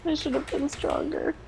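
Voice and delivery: high, soft, self-blaming whimper; tearful. Acted. Crying girl blaming herself to her friend, tearful. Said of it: "She's just crying and crying".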